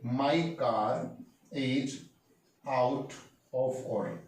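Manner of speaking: each word set apart with pauses; slow, spaced-out model sentence. Shown as a demonstration. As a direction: lay it out word by word for learners. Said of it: "तो बोलेंगे".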